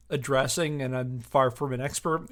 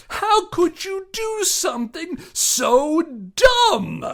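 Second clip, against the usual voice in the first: much higher, slow and sneering; mocking scorn; 8 dB louder, much slower than usual. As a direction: drag it out in disbelief at their stupidity. Mocking rhetorical jab in letter.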